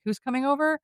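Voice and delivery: high-pitched retold question; mildly acted question. Mina walks in asking who's coming over, lightly acted high question. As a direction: ask it high, lightly playing a newcomer.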